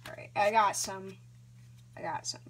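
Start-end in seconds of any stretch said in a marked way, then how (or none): none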